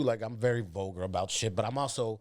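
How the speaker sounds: normal talking voice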